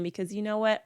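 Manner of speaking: ordinary talk, nothing marked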